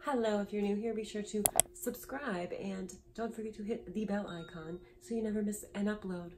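hosting a talk show; upbeat show host intro. Pretend. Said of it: "I don't know why I'm talking like this".

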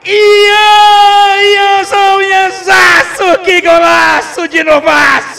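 shouting the scorer's name; explosive goal commentary. Real, not acted. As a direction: roar the goal call, stretching each name. Goal celebration call.

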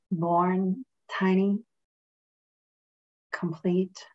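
calm, measured meditation voice with long pauses; guided meditation. Real, not acted. Reverend leading friends in a breathing meditation, calm and unhurried.

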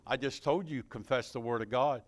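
ordinary delivery

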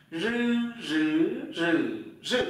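"Rư" buzzed with tongue tip curled, through four Mandarin tones; curled-tongue voiced r. Shown as a demonstration. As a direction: curl up and voice each "rư", changing tone. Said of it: "R cong lưỡi vào dây thanh". (đọc "rư" cong lưỡi, rung dây thanh)

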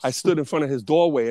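ordinary talk, nothing marked

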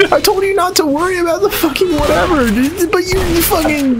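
high, exasperated outburst; frustrated yell. Real, not acted. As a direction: vent at your teammate. Frustrated at teammate.

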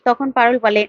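plain reading voice

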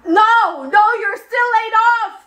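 high, fast, emphatic no; frantic retraction. Acted. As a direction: cut them off urgently, pitched high. Acted boss frantically retracting offer.

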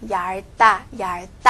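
얇다 said yal-tta, tense tt; tensed 다. Shown as a demonstration. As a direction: demonstrate 얇다 with the ㅂ silent. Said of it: "把它变成了这个重音".

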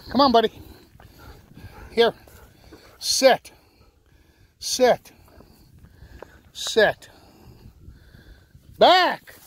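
high, loud calls to a dog; shouted commands. Real, not acted. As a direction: call the dog in, then order sit.

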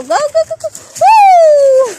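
high, loud whooping cheer; excited cheering. Real, not acted. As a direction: egg the dog on, rising to a whoop. Cheering on the running dog.

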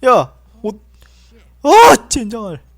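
loud high curse; angry yelp. Real, not acted. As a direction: shout the curse out. Losing in game.

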